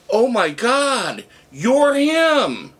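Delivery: high, stretched-out astonishment; retold gasping-in-wonder reaction, slow and high; amazed recognition. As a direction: act out a waitress suddenly recognising someone. Waitress acted recognising him.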